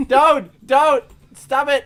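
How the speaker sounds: high, loud pleading squeal; being tickled. Real, not acted. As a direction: squeal at them to stop.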